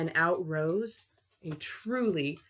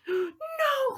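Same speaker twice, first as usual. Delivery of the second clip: frightened cry. Acted. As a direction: shriek in fright. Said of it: "cried the witch".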